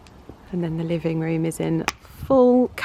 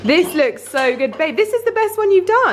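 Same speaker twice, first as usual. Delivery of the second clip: excited praise; 11 semitones higher, 3 dB louder than usual. Real, not acted. Praising husband's roast.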